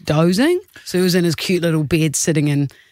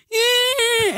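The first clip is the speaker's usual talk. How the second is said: put-on high, drawn-out baby cry; imitated baby wail. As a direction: wail like an unhappy newborn. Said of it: "looking at the camera, literally going".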